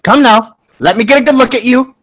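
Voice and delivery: high, loud mother beckoning someone over; high-voiced mom demanding a look; fussing mother. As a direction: pitch it up like a bossy mom. Game master voices Stony's mother.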